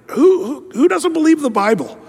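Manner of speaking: rising disbelief, pressing the crowd; incredulous challenge. Real, not acted. Pastor challenging the congregation, high incredulous. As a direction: pitch jumps high on who doesn't believe.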